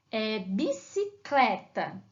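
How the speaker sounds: bicicleta stretched out slowly, syllable by syllable. Shown as a demonstration. Teacher sounding out a word for pupils, slowly. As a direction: draw out bicicleta for the kids. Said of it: "olha, a palavra".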